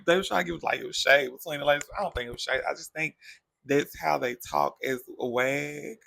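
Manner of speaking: ordinary talk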